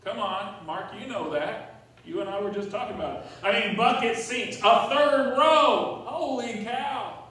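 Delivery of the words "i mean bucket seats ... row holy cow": loud, high, slow amazement; excited. Real, not acted. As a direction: marvel at the minivan. Excited about minivan features.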